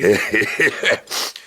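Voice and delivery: low little giggle; short self-pleased laugh; amused chuckle. Acted. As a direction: chuckle to yourself in anticipation. Captain amused before composing poem.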